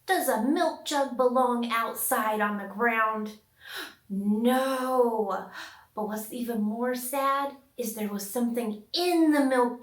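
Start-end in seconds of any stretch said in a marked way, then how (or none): none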